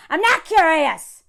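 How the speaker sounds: loud, slow, emphatic refusal; mock indignant retort. Dude answering someone reasonable, mock indignant retort. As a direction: push back loudly, stretching the words. Acts out the dude's angry reply.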